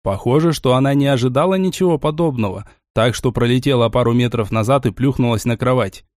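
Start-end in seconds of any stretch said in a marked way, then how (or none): none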